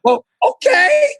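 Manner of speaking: very high, slow stretched okay; mock reluctant okay. Host giving in to advertisers, high and stretched. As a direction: give in with a squeaky shrug. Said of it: "I said".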